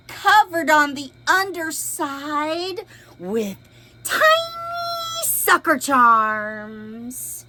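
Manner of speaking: lively storybook read-aloud; expressive picture book reading; reading to children. A narrator's reading. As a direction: read it big for little listeners.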